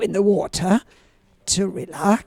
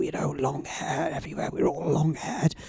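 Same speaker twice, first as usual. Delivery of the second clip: normal talking voice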